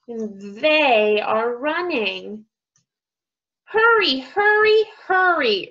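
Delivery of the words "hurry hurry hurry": high, loud storybook urging; insistent high calls. A narrator's reading. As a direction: push them along, word after word. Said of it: "urged Bear".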